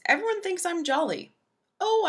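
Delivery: usual speaking voice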